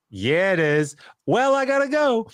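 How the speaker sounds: nervous getaway, pitch jumping up; panicked exit line. Retold or imitated. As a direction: squeak it out as you bolt. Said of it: "And I was like".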